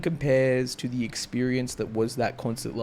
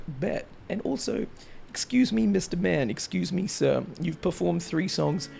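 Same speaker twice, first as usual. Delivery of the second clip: higher, mock-indignant retort; sassy comeback; 4 semitones higher than usual. Fan sassing the idol about having no voice left. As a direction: talk back like a cheeky fan. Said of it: "And I was like".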